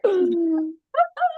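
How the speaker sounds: sharp, high gasp; mock gasp of shock. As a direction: gasp as if stung by the remark. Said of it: "And I was like".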